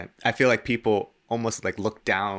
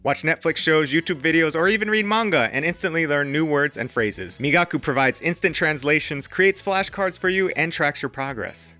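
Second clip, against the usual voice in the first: upbeat, polished ad read over music; bright, energetic read of a sponsor spot; 6 semitones higher, 4 dB louder than usual. Acted. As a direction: sell the product cheerfully.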